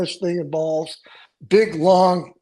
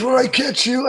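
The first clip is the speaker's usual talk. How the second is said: high yelling while on the run; retold yell; 9 semitones higher than usual. Jim chasing him through the set, yelling higher. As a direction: call out the threat mid-chase, pitched up.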